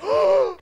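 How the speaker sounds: very high, loud shocked gasp; loud, very high startled gasp; sharp gasp. Real, not acted. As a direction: gasp in sudden alarm. Sudden shock in game.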